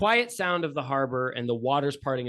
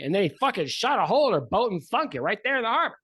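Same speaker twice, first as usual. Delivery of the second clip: higher, louder and fast, bursting out; excited punchline; 7 semitones higher, 6 dB louder, faster than usual. Acted. Steve shocks the couple with the sinking, excited and fast. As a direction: blurt the shocking end.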